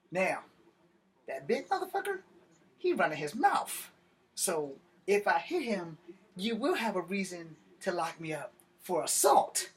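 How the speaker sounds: high-pitched, deliberate warning; emphatic warning. Retold or imitated. Cuffed man warning the cop about assault, emphatic warning. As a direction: lay out the threat with high, swooping emphasis.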